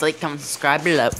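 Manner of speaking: fast throwaway outro; rushed. Real, not acted. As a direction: rattle off the sign-off quickly.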